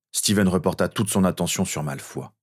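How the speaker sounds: usual speaking voice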